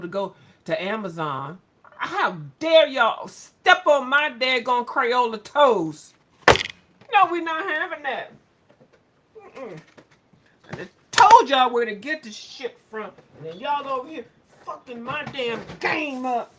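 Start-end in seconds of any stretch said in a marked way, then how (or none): 2.0-9.8 mock outrage. Real, not acted. Ranting at viewers. 10.7-15.6 loud scolding. Real, not acted. Ranting at viewers.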